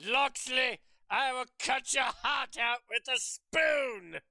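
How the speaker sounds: high, loud, slow shout; furious villain threat. Acted. Villain threatening Loxley, acted furious shout. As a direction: stretch the threat into a theatrical roar.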